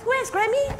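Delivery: high sing-song asking; playful question. Retold or imitated. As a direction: quiz the baby gently. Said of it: "she can recognize".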